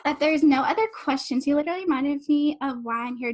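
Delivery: ordinary delivery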